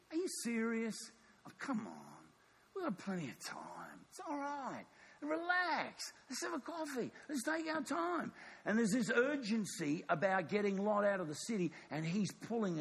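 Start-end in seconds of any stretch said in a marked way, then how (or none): 0.1-4.0 dismissive attitude. Acted. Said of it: "an attitude is gonna grow up". 4.1-8.3 high-pitched, soft easygoing reassurance; dismissive laid-back attitude. Acted. Complacent people putting off God's urgency, breezily dismissive. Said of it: "an attitude is gonna grow up".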